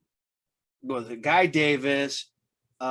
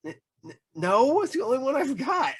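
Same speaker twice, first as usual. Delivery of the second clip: stammered no, voice jumping much higher; startled, stammering refusal; flustered refusal; 10 semitones higher than usual. Retold or imitated. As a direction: stammer it out, caught off guard and clinging to the book. Refusing to give up his book.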